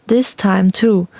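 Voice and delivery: usual speaking voice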